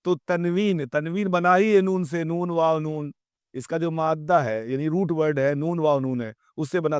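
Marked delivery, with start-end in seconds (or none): none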